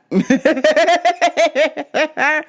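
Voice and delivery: high, rapid cackling laugh; real laughter. Real, not acted. Teller breaking up at his Tommy answer, real laughter. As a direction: crack up at your own joke. Cracks up at own bit.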